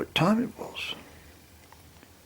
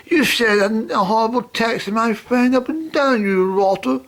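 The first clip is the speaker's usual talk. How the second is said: mock telling-off; 10 semitones higher, 4 dB louder than usual. Scolding the friend in a row.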